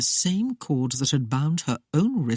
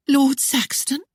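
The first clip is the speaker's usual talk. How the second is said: higher questioning voice, bewildered; 10 semitones higher, 3 dB louder than usual. A narrator's reading. Claudia repeating the name to Christopher, higher bewildered voice. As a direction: ask the name back in disbelief. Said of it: "repeated the name in widening bewilderment".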